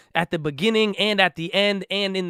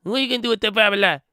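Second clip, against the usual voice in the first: mocking hater; 4 semitones higher, faster than usual. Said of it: "you're like".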